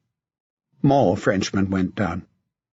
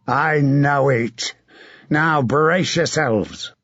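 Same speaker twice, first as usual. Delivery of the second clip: a battle-hardened knight urging a comrade on; hard, rough commander's voice; grim veteran knight giving orders; gruff knight; 2 semitones higher, slower than usual. Acted. Sir John before the charge.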